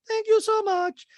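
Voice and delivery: very high, loud, slow falsetto; hammed-up gushing squeal; Diana impression. Diana thanks a guest, in drawn-out mock falsetto. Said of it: "And Diana goes".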